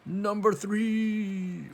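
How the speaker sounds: slow, high, loud cartoon call; exaggerated cartoon intonation. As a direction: draw it out like a game show host. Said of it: "I talk like a cartoon character".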